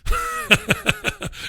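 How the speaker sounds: high, wheezy giggle; laugh. Real, not acted. Host amused at insulting England, high giggle. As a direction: giggle at your own cheek.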